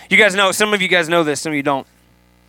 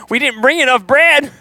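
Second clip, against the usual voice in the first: much higher, fretful disciples voice; puzzled disciples; 8 semitones higher than usual. Retold or imitated. Disciples fret to Jesus about bread, high fussy voice.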